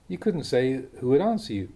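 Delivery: ordinary talk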